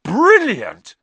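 high, loud delighted cry; thrilled. A narrator's reading. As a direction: shout it with glee. Twins thrilled about Knockturn Alley.

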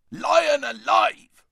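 loud, high, slow dwarf roar. A narrator's reading. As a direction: bellow it out like a startled dwarf. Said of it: "roared the dwarf".

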